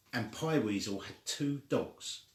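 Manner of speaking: plain reading voice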